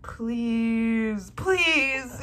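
loud pleading please; loud tearful begging; crying plea. Real, not acted. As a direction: beg him loudly through happy tears. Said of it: "I'm crying".

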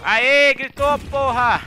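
high, loud victory shout; roaring with joy, drawn out; triumphant yell. Real, not acted. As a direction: celebrate at the top of your lungs. Celebrating the scream at last.

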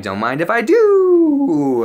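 high, loud, long drawn-out whoop; exuberant, stretched-out party cry; gleeful acceptance. Retold or imitated. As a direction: sing out the last word with relish. Gleefully accepting the offered line.

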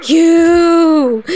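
loud, high mock dog voice yelling; a dog's excited yell. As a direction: voice the dog bursting in at the door. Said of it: "my dog's like".

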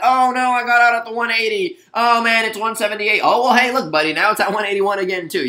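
high, loud whiny trader moaning about a missed move; high mock lament from a trader who sold too early; mock whining. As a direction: play a sore trader complaining, hammed up. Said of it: "can't get upset about the fact that".

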